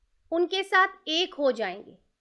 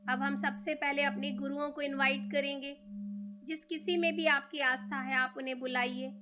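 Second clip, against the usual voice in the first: guided meditation. Real, not acted. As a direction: ease them into stillness, leaving room to breathe.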